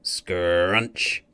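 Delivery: plain reading voice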